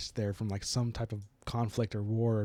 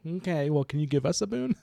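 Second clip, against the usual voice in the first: higher, louder playful spirit voice; pitched-up mock pleading; spirit's wheedling request; 5 semitones higher, 4 dB louder than usual. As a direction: lightly act out a spirit asking a favour. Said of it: "And the spirit's like".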